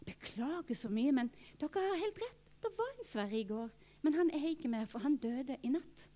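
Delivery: soft, hushed, apologetic; solemn news. Retold or imitated. Receptionist telling visitors the man died, hushed. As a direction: break the news gently.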